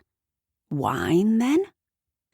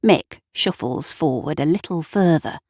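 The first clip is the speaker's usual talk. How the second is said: usual speaking voice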